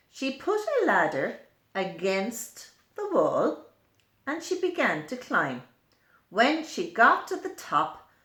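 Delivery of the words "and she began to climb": plain reading voice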